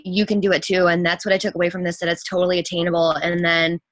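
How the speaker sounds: normal talking voice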